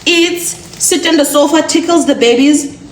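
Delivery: loud, fed-up rant about a useless husband; loud, fed-up wife venting about her husband; exasperated rant. Acted. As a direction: vent it at full volume. Ranting about a lazy husband.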